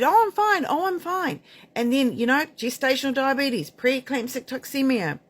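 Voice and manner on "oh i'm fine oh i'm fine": high mock brush-off, first one louder; mocking "I'm fine". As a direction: parody their breezy denial in a much higher voice. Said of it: "women that have said".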